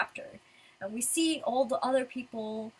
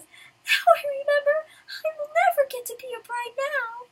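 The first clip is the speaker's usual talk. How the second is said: hammed-up despairing wail; 11 dB louder than usual. [name] remembering her drunken night, mock despairing wail. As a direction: wail it like a ruined bride-to-be.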